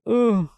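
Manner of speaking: high strained groan; pained groan. Retold or imitated. Throwing the weed away for the cop, a high miserable groan. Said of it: "crying. I was, like, cold".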